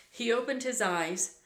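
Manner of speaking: ordinary delivery, nothing marked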